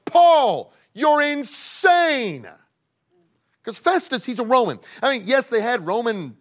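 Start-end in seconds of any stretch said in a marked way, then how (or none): 0.1-2.5 high, loud and drawn out; loud shout. Acted. Festus the governor shouting at Paul, loud drawn-out call. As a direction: call him mad across the courtroom.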